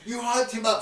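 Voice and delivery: high, loud mock accusation; indignant bettor. Game master as losing bettor, loudly indignant. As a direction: complain loudly as a cheated punter.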